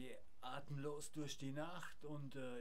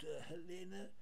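ordinary talk, nothing marked

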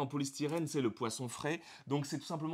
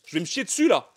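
loud, high delighted cry; excited shouting; 5 semitones higher, 10 dB louder than usual. Real, not acted. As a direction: gush over the statue loudly. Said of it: "je vais faire du bruit deux secondes".

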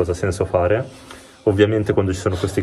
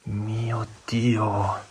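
breathless awed whisper; trembling whisper; 9 dB quieter, much slower than usual. Real, not acted. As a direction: hush it out in shock. Awed at house full of toys.

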